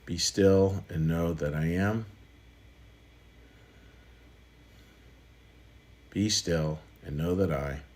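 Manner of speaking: slow, soft, with long pauses between each shortened line; guided meditation. Acted. Pastor guiding the congregation into quiet meditation, slow and soft. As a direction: let each line fall away into stillness. Leads meditation dropping words.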